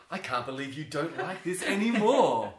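loud, high, incredulous protest; hammed-up indignation, climbing and stretched at the end; mock outrage. His outraged comeback to adults.

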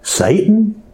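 high, rising disbelief; incredulous question. Acted. Peter reacting to being called Satan by Jesus, incredulous. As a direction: echo the name in stunned disbelief.

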